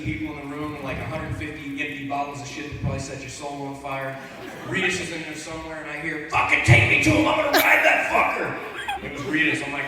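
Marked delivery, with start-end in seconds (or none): 6.3-8.6 put-on high, loud, breathy drunk holler; hammed-up yell of a wasted buddy; drunk friend yelling. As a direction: friend yelling from across the hotel room. Friend Rita's voiced yelling.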